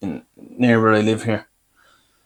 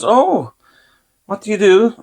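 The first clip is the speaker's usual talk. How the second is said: chatty dentist; 9 semitones higher than usual. Retold or imitated. Dentist quizzing a patient about his job, lightly voiced in a higher pitch. Voicing dentist in retold story.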